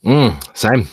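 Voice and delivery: talking through a mouthful of food; words round a bite of meat; mouth full. Real, not acted. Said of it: "Sounds like you still got a mouthful".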